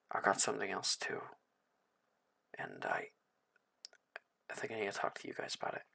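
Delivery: soft, hushed and fast; leaning in quietly. Acted. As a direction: murmur it close so nobody hears. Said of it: "lean over toward you and say".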